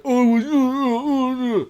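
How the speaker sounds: very high, slow, drawn-out stammer; blustering bully stammer. Retold or imitated. Goliath blustering at Ian's warning, stammering. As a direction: bluster without finding words. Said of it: "his general sort of posture".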